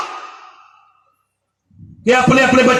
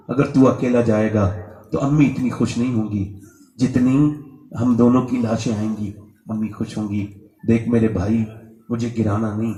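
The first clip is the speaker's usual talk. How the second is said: put-on young boy begging his older brother not to throw him; boy's soft plea; 13 semitones lower, 5 dB quieter than usual. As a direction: lower and softer, intimate pleading. Boy softly pleads with brother.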